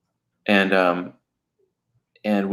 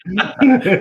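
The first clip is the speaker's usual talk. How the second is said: sudden high laugh; burst of laughter; 10 semitones higher, 5 dB louder than usual. Real, not acted. Laughing at his own stripper joke.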